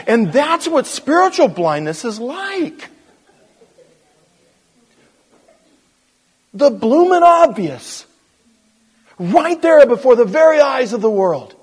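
high, full-force pulpit yell; exasperated preaching shout. Real, not acted. Preacher tells congregation what spiritual blindness is, exasperated shouting. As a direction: drive the point home at a high, loud pitch. Preacher drives home the point, worked up.

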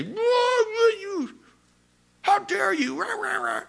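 high, outraged mock squawk; jumping on someone. A listener snapping back at an accuser, high mock outrage. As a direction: bristle up and squeal back in offense. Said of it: "jump on it and say".

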